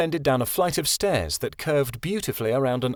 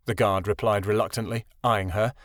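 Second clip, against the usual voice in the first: plain reading voice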